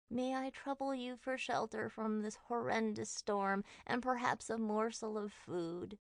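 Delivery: high, whiny troll pleading. A narrator's reading. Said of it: "high-pitched and whiny voice".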